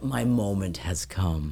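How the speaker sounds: low, slow, hammed-up hero line; mock dramatic line. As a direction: play the neighbor savoring his big moment, slow and low. Neighbor's dramatic quote.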